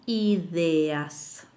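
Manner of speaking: "ideas" stretched out as i, de, as; separated syllables. Shown as a demonstration. Teacher giving a hiatus example, "ideas" said in separated syllables. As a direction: say "ideas" very slowly, each vowel in its own syllable. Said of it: "se pronuncian en sílabas diferentes".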